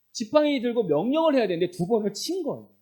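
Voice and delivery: normal talking voice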